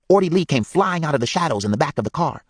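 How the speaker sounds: ordinary delivery, nothing marked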